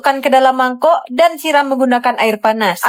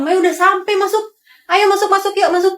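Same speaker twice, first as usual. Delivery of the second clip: eager welcome; 7 semitones higher than usual. Acted. Teacher welcomes students in.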